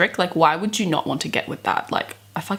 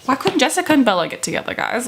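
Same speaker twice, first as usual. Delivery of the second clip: fast, high frustrated plea; rushed lament; 6 semitones higher, faster than usual. Real, not acted. Vlogger pleading with viewers over the couple, fast and high. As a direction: rattle it off in mock despair.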